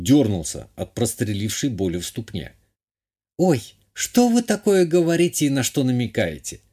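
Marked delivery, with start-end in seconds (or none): 3.4-6.6 high-voiced lady, playfully protesting; lightly put-on coquettish countess; coy countess. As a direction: act innocent in a raised feminine voice.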